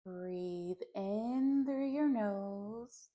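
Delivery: slow, drawn-out meditation voice with long pauses; guided meditation. Acted. Teacher leading listeners through a clearing breath, slow guided meditation. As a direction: guide each breath slowly, stretching the words.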